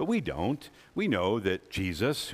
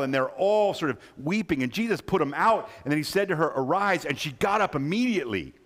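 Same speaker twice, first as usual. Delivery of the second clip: higher and louder, driving preacher's build; preaching at full force; 8 semitones higher, 4 dB louder than usual. Acted. Preacher telling the church of the girl raised, full force. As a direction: lift the congregation with the miracle.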